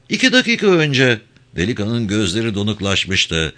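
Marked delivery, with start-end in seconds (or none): 0.1-1.2 put-on high-pitched, intimidated young man; cowed clerk answering under pressure; frightened clerk. A narrator's reading. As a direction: blurt it out in a high, scared voice.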